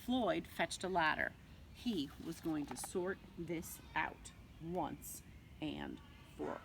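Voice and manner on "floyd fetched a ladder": plain reading voice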